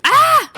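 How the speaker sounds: short, high, loud cry; startled yelp. Real, not acted. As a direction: yelp as if suddenly hit. Startled while fighting a drowned.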